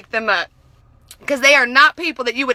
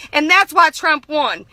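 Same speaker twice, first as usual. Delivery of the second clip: ordinary talk, nothing marked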